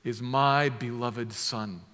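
a booming "This", then a slow, solemn "is my beloved Son"; voice of God. Acted. God speaking from the cloud over Jesus, booming then slow and solemn. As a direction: proclaim it like a voice from the cloud. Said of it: "And the voice says out of the cloud".

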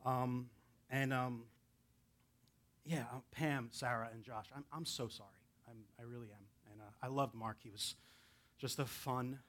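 soft, halting, close to tears, trailing to a whisper; real grief held back for a lost friend; choked up. Real, not acted. Guest pastor offers condolences to Mark's family, choked up. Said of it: "I'm getting, like, choked up".

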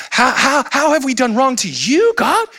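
put-on high, whining child protest; whiny child. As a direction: whine it like a caught kid. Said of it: "like little children who are caught".

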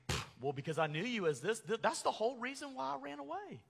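huffy self-justifying protest, climbing high; puff, then whine the excuse upward; making excuses. Acted. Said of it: "you also see here how he excuses his sin".

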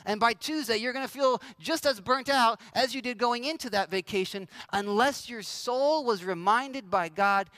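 ordinary delivery

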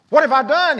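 loud, high, desperate plea; desperate cry. Acted. David crying out to his friend Jonathan, loud and desperate. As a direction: cry it out like a man falsely accused.

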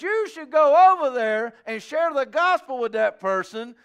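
loud, high, urgent preaching; preaching at full force. Acted. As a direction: press the point on the room. Preaching climax.

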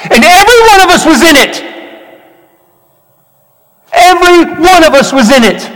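high, loud, impassioned sermon; full-force preaching. Real, not acted. Preacher challenging congregation about Jesus's sacrifice, impassioned shout.

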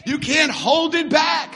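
slow, high, loud exhortation; full-force preaching. Real, not acted. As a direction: cry it out to the congregation.

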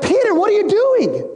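high-pitched mock reproach; acted disbelief. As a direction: react in disbelief to a friend's rash act. Reacting to Peter slicing an ear.

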